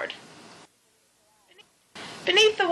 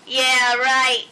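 louder, drawn-level dismissive scoff; scornful snort; 4 dB louder than usual. A narrator's reading. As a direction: scoff it like you don't believe a word. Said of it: "snorted Luella".

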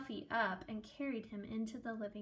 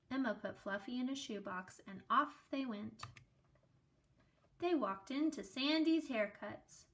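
usual speaking voice

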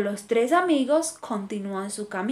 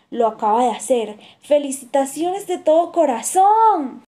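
ordinary delivery

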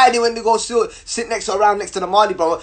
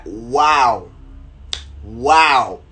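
low, long drawn-out wow; stunned wow; 7 semitones lower than usual. Retold or imitated. Reacting as she pulls out her phone, stunned. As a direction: let it sink in, deep and slow.

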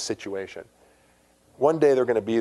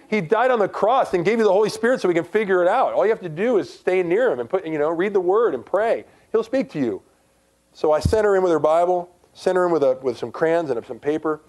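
raised, high-pitched preacher's delivery; emphatic sermon, pitched up high; impassioned preaching; 8 semitones higher, 4 dB louder than usual. Real, not acted. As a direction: drive it home to the congregation. Preaches with full force again.

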